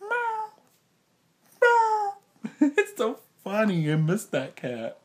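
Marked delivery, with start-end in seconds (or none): 0.0-2.1 very high imitated cat meows, the second louder; squeaky playful meow. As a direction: meow like a kitten. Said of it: "I'll go".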